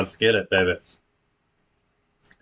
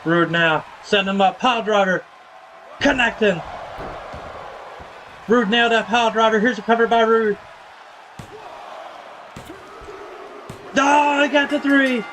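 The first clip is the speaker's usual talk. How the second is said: higher-pitched, worked-up ringside call; excited wrestling play-by-play; 11 semitones higher than usual. Acted. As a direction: call the big finish and protest the referee's miss. Calls pile driver, cover and disputed pinfall.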